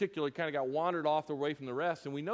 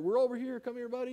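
put-on higher, kind call to a lost kid; calling kindly; 8 semitones higher, faster than usual. Leader calling a lost junior higher in the dark, higher and kind. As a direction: coax him back gently. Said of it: "shining light".

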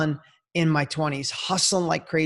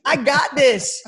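pumped up; 8 semitones higher, 4 dB louder, slower than usual. Retold or imitated. Pumped up getting ready.

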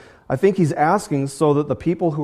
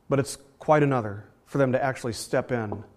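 normal talking voice

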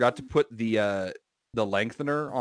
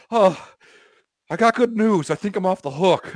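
high, loud, out-of-breath cartoon voice; Robbie; 7 semitones higher, 5 dB louder than usual. Friend announcing good news in the hallway, mock out of breath. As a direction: imitate a friend puffing out his news. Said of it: "Robbie sounds like he's out of breath".

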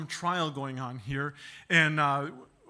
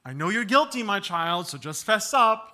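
parent pressing; 3 semitones higher, 8 dB louder than usual. Retold or imitated. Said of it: "parent pressing child to confess".

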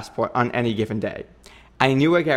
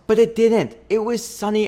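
delighted surprise; 9 semitones higher, 4 dB louder than usual. Real, not acted. Happy surprise about weather.

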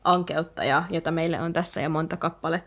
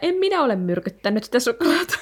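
laughing, louder and higher; laughing quote; 6 semitones higher, 6 dB louder than usual. Retold or imitated. Co-host quotes Lupin's chocolate line, laughing loudly.

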